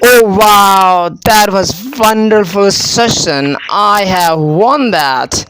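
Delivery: slow, hammed-up excitement; showing excitement. Shown as a demonstration. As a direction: draw out the key words with delight. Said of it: "So I'm showing my excitement".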